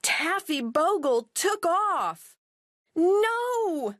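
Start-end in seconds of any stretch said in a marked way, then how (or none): 2.9-4.0 high sharp cry of alarm; cried out. Acted. Millie cries out as the dog chases a cat, high alarm. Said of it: "cried Millie".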